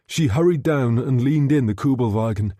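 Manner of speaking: ordinary delivery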